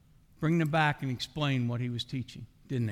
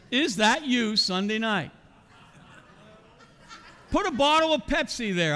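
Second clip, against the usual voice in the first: high, loud, pressing challenge to the congregation; fiery preaching; 9 semitones higher, 4 dB louder than usual. Acted. Preacher challenging the church about Sunday nights, loud and high. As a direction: call them out from the pulpit.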